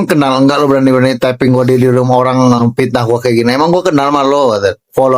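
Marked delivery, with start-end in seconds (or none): none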